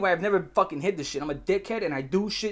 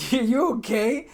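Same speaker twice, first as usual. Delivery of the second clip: slow, stretched-out, halting question; retold uneasy check-in; concerned disbelief; 5 semitones higher, 4 dB louder, much slower than usual. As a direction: check on him warily, half laughing. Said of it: "Are you like".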